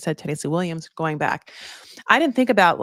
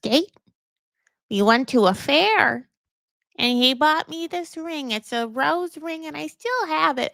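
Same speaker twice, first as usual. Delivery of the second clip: put-on high, slow, sweet old lady, pitched well up; 5 semitones higher than usual. Nice old lady at the home recalls her soldier husband, high and slow. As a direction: tell it like a nostalgic old woman reminiscing. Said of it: "this one nice lady is like".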